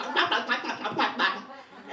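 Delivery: loud twangy mock Vietnamese babble; rubber-band gibberish. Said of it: "So it just sounds like".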